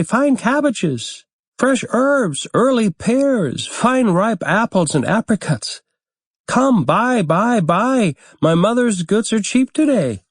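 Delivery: put-on high, piping market cry of a small boy; shrill child's call. A narrator's reading. Little Jakob calling to market shoppers, high and shrill. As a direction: call out to passing buyers like an eager little boy. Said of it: "called out in a shrill little voice".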